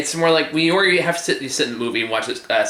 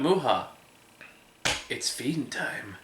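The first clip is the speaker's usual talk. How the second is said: creepy voice; 10 dB quieter than usual. Acted. Creepy voice clip in review.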